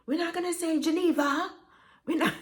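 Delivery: high, quick mock refusal, GENEVA ending in an open "ah"; stepmother correcting pronunciation. Stepmother rejecting a name's pronunciation, GENEVA ending "vah". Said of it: "this Diane Carroll demeanor about herself".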